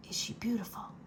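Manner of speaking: soft voiceless whisper. Acted. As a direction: lean in and whisper it secretly. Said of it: "the threadmaster whispered".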